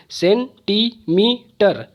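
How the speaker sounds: "Centimetre" in four beats, सेन, टी, मी, टर; syllable by syllable. Shown as a demonstration. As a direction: separate each syllable of "centimetre". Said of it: "चार बार मुझे बोलना पड़ा है". ("Centimetre" को चार टुकड़ों में बोलकर)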